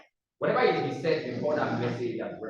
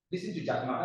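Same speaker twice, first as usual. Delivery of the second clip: normal talking voice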